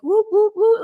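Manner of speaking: high, loud whoops; imitated whooping calls. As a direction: hoot out repeated high whoops. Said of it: "I could hear this, like".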